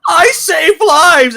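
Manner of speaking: high, slow, tearful imitation; Good Doctor meme voice. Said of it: "He pulls out, like".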